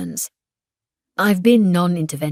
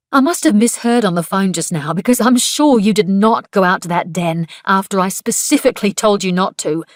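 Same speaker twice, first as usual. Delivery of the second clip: angry confrontation; 3 semitones higher, 7 dB louder than usual. A narrator's reading. Woman accusing her coworker, furious and loud. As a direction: let her fury spill at Niels.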